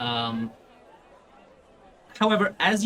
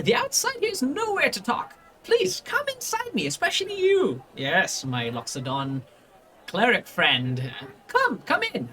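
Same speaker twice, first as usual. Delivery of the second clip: Volo, welcoming; 9 semitones higher than usual. Acted. Volo inviting the party inside, high and fussy. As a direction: usher them in with oily courtesy. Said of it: "he just goes like".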